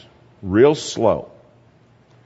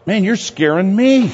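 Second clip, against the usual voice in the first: scared laugh; 8 semitones higher than usual. Retold or imitated. Mock-frightened reply to the stranger.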